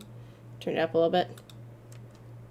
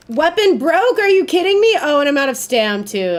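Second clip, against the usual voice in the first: loud, high outraged yelling; furious disbelief; 7 semitones higher, 6 dB louder than usual. Real, not acted. Gamer yelling as his weapon breaks mid fight, in outrage. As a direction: yell it like you can't believe it.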